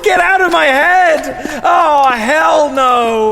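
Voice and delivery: possessed panic. Acted. As the sass possesses him, hammed-up panic. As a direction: fight the voice inside your head. Sass takes over his head.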